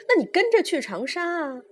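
high, loud angry outburst; old mother's furious order, pitched high; sudden fury. A narrator's reading. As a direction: explode at your son. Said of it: "老太太勃然大怒".

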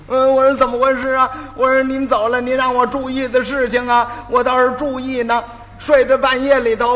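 hammed-up sob story to dodge a beating; whining fake plea. Apprentice spinning a tearful excuse to the master. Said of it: "我，我就哭".

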